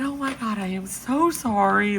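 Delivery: high-pitched retold apology; mortified apology. Mortified apology to vet staff.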